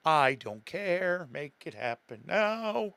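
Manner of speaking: drawn-out, slow insistent demand; impatient demand. Retold or imitated. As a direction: mimic someone insisting, stretching each word. Mimics the impatient underground guy.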